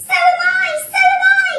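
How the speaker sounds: high, loud frantic agreement; panicked cry. A narrator's reading. Mister Twit feeling giddy too, panicked cry. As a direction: cry out in alarm. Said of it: "cried Mister Twit".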